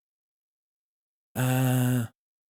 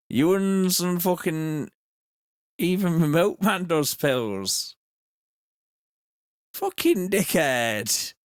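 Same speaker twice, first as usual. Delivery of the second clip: slow, sneering lad, high on the last insult; lightly voiced rough local lad; Devo voice; 3 semitones higher, slower than usual. Streamer ending the Devo bit with insults, slow and sneering. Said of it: "you did the Devo voice".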